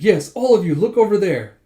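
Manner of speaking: loud, high, urgent command; flustered order. Acted. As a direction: shoo the onlookers' eyes away. Ordering onlookers to look away.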